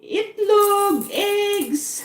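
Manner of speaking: high, mock market scream without much volume; imitated vendor cry. Bilog imitates ladies screaming at the market, mock cry. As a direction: call it out like a vendor.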